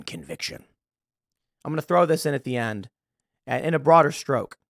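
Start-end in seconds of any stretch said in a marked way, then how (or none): 0.0-0.6 unvoiced whisper of the word; soft whispered repeat; whispered echo. Real, not acted. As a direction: breathe the word out. Echoing the word.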